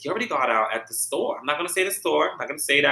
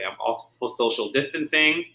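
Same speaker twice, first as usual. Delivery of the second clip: ordinary talk, nothing marked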